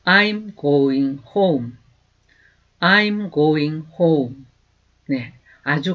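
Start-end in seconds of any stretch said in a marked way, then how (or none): 0.0-1.7 slow, clear teacher's model, each word apart; slow demo pronunciation; slow model sentence. Shown as a demonstration. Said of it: "I'm으로 줄여서". 2.8-4.5 slow, clear teacher's model, each word apart; slow demo pronunciation; slow model sentence. Shown as a demonstration. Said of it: "I'm으로 줄여서".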